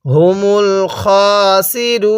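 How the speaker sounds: slow recitation with খাসিরুনা drawn out; Quranic recitation. Shown as a demonstration. Teacher correcting the খা, slow recitation. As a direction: recite খাসিরুনা slowly, holding খা. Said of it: "অনেকেই খাসিরুন, খ খারা জবর".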